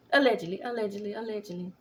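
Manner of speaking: fast, teasing allegedly; rapid disclaimer. Real, not acted. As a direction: rattle it off quickly.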